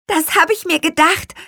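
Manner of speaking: high, bright and loud; beaming. A narrator's reading. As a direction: say it glowing with joy. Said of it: "strahlte Lea". (strahlend, hoch und laut)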